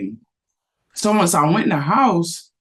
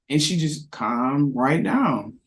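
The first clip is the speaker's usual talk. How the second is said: ordinary talk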